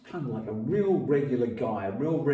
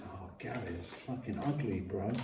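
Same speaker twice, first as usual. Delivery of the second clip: hushed report; 2 semitones lower, 9 dB quieter than usual. Retold or imitated. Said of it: "and she went".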